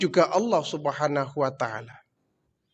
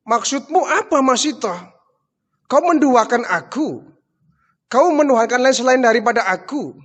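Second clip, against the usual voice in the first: high, loud outraged questioning; outraged demand; 8 semitones higher, 5 dB louder than usual. Acted. Pharaoh outraged at servant denying his godhood, high angry questioning. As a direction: demand an answer, pitched up and stung.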